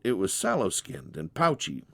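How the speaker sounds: ordinary delivery, nothing marked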